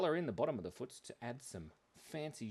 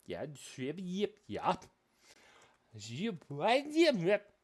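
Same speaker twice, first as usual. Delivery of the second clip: slow, high, stretched nonsense syllables; really weird way; 7 semitones higher, 6 dB louder, much slower than usual. Shown as a demonstration. Streamer demonstrating backwards birthday, in a really weird way. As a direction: draw each syllable out, weird and silly. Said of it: "you have to say it in that really weird way".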